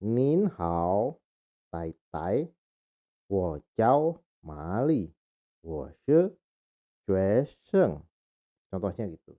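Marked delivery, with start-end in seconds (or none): 0.1-8.0 slow, careful Mandarin introduction; Mandarin phrase read aloud. Shown as a demonstration. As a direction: read the sample dialogue in Mandarin. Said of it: "Memperkenalkan diri".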